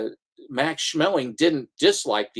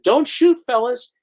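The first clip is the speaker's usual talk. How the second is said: mock surrender; 9 semitones higher, 4 dB louder than usual. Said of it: "And he said".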